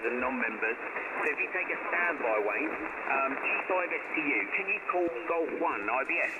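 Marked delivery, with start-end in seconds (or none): none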